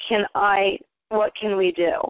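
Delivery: ordinary delivery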